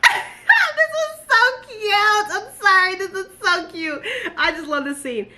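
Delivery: very high, slow squeal broken by giggles; laughing squeal. Real, not acted. Reader giggling over a cute scene, high and laughing. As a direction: giggle and gush, can't hold it in.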